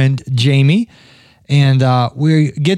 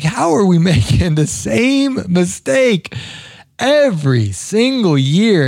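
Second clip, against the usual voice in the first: laughing disbelief; 4 semitones higher, slower than usual. Retold or imitated. Complaining to friend about overbooking.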